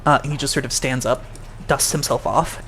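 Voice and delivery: ordinary talk, nothing marked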